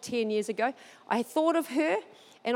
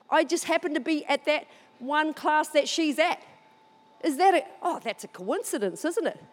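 ordinary delivery

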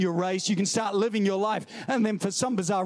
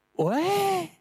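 much higher, startled "What?"; comic disbelief; mock shock; 10 semitones higher than usual. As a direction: sound caught off guard. Believer shocked at leaving.

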